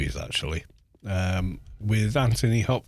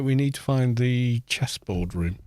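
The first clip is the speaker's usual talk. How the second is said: usual speaking voice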